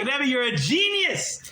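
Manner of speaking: high, drawn-out gush of delight; excited. Retold or imitated. Todros flattering the Rebbe over his plan, excited. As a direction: stretch it out in thrilled admiration. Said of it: "Todros is very excited".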